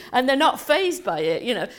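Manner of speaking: normal talking voice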